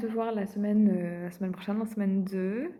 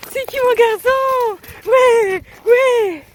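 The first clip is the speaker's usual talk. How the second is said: talking to a dog; 12 semitones higher, 12 dB louder than usual. Real, not acted. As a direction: gush over the dog in a high voice. Praising dog after run.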